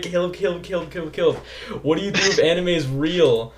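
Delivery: repeating his own written question through giggles; mock-read it while barely holding in laughs; laughing reread. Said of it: "why did I just write".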